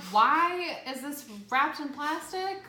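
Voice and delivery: high, slow, incredulous complaint; exasperated disbelief. Retold or imitated. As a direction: stretch it out in baffled outrage. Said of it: "I'm like".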